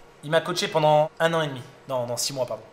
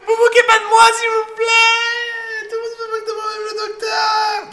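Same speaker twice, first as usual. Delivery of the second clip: put-on girly sobbing plea; 7 dB louder than usual. Commander begging the doctor not to mock him, squealing wail. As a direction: wail like a hurt little girl. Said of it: "une voix de meuf".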